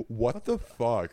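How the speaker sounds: slow, stretched-out "what the fuck"; drawn-out disbelief. Retold or imitated. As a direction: draw each word out in mock disbelief.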